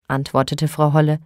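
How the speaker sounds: ordinary delivery, nothing marked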